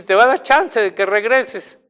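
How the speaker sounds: imitación de lloro, algo fuerte; cítalo como quien lo contó llorando; llanto imitado. Retold or imitated. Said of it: "Y lo decía llorando". (llorando de imitación)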